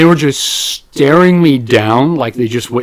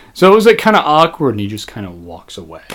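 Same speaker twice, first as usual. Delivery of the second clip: ordinary talk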